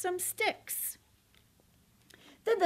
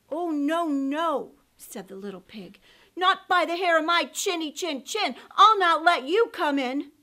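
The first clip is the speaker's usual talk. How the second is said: loud frightened refusal; scared little pig; 3 semitones higher, 8 dB louder than usual. Acted. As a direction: cry it out defiantly.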